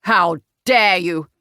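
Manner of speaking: slow, loud, outraged father; deep-voiced indignation. A narrator's reading. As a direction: draw out each word in affront. Outraged father rears back.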